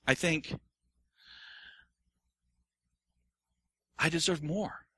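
understated whiny self-pity; mock self-pity. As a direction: pout and feel sorry for yourself. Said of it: "Self-pity".

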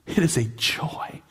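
hushed, slow, reverent whisper. Real, not acted. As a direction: breathe it out in a whisper. Whispered repetition after spoken line.

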